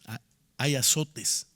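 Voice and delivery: ordinary delivery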